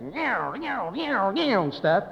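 whining meows mimicking heavy metal guitar; mock head-banging guitar noise. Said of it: "that head-banging stuff".

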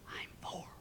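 tiny hushed whisper; whispering child. Acted. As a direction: whisper it secretly like a little kid. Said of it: "Still whispering, the voice said".

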